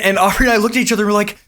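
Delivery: ordinary delivery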